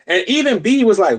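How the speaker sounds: normal talking voice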